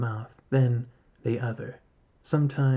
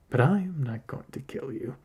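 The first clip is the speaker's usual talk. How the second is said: ordinary delivery, nothing marked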